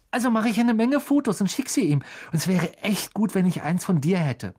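put-on high, breathless-with-joy boy fan; gushing excitement; thrilled. A narrator's reading. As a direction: bubble over with enthusiasm, high-pitched. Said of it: "holte vor Begeisterung tief Luft".

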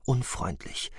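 ordinary delivery

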